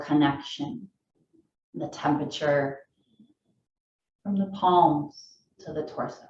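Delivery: calm, low-key meditation voice, long pauses; unhurried phrases, each left to settle; guided meditation. Acted. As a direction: guide them gently into the breath.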